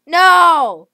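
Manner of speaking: high, loud cry of no; real dismayed shout. Real, not acted. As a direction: wail it as you lose. Eaten in game.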